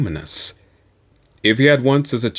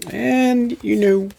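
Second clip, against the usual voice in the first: high shrug; 13 semitones higher, slower than usual. Acted. Persona shrugging it off.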